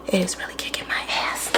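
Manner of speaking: hushed whisper. Real, not acted. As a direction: drop to a whisper for the confession. Aside about pregnancy being hard.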